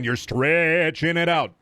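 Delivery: "stretching" pulled out long; drawn-out STRETCHING. Real, not acted. Streamer mocking padded TV stories, "stretching" drawn out. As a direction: drag the vowel of "stretching" to match its meaning. Drags the word like its meaning.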